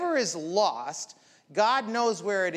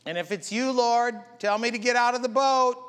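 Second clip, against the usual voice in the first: higher, louder call across the water; Peter crying out; 7 semitones higher, 6 dB louder than usual. Retold or imitated. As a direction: shout it out over the storm. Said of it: "sometimes we gotta cry out".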